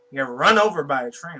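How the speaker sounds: ordinary delivery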